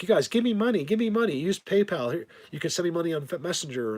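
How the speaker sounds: high, pushy mock plea for money; mock begging. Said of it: "But to go out and just be like".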